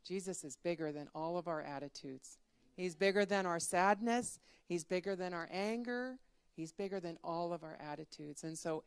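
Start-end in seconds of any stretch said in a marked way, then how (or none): none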